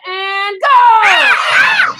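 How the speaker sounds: loud, drawn-out starting call; shouted start command. Real, not acted. Coach sends kids into partner drill, shouting the start. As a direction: launch the whole class into the drill.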